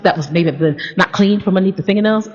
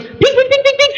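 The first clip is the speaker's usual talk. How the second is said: high sung pings like fireworks; playful firework noises. Retold or imitated. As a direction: mimic fireworks going off.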